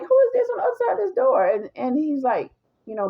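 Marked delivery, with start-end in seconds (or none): 0.0-1.5 high, fast, baffled question; who on earth is that, high and quick. Retold or imitated. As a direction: girlfriend hearing a stranger at the door, baffled. Baffled at knock on door.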